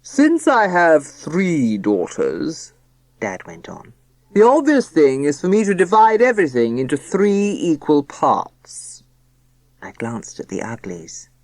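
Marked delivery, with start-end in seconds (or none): 0.0-2.7 very slow, grand self-important father; solemn pompous voice. Acted. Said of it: "solemn, pompous voice". 4.3-9.0 solemn pompous voice. Acted. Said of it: "solemn, pompous voice".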